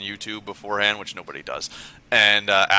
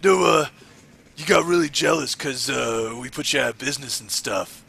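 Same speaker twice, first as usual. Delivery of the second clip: lightly voiced wrestling boss impression; a hint of a put-on Triple H voice; light mock Triple H; 2 semitones higher than usual. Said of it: "you do the best, uh, Triple H".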